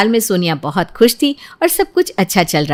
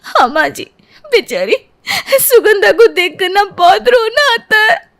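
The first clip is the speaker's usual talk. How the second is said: weepy over serial; 12 semitones higher than usual. Acted.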